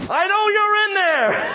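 high, drawn-out holler; comic shout. Retold or imitated. Bold pastor at a stranger's door, hollering comically.

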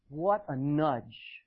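ordinary delivery

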